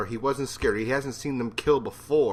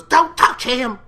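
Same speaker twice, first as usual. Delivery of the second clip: higher, louder shout; 12 semitones higher, 10 dB louder, slower than usual. Retold or imitated. Maggie yelling at Shane to stop. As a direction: yell at him to back off. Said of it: "It was great acting".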